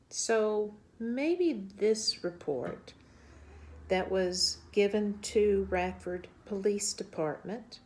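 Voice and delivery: slow, grave narration with deliberate pauses; documentary narration. Acted. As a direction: lay out the case, measured and serious. Narrates findings to camera.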